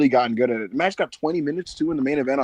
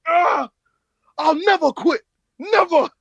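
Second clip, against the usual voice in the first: high, loud hammed-up defiance; mock defiant wrestler; 6 dB louder, slower than usual. Wrestler refusing to quit, in high hammed-up yells. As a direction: yell it like a stubborn wrestler. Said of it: "And he's like".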